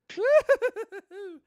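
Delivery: high, loud giddy character cackle; cackling giggle. Acted. Playing Missus You Know Who for the stream, giggling. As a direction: giggle like a flirty cartoon lady. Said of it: "cue up Missus You Know Who".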